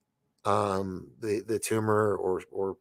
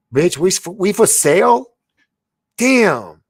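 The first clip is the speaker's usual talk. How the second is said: gasp, then much higher, louder outraged disbelief; shocked; 10 semitones higher, 12 dB louder, slower than usual. Real, not acted. Reacting to chat claiming aliens are coming, shocked. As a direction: gasp and blurt it out, stunned.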